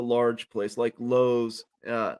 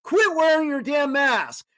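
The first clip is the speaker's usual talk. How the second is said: retold yell at crowd; 11 semitones higher than usual. Telling shoppers in line to drop their masks, high retold rallying yell. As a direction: rouse the whole line like a street preacher. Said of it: "I'm yelling at this guy".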